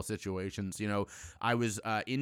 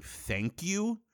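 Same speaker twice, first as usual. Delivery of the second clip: high, hollow mock gratitude; mock thank you; 10 semitones higher than usual. Mocking a powerful politician's hollow thank you. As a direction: sneer it in a prim, high little voice. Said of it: "most powerful people in this country saying".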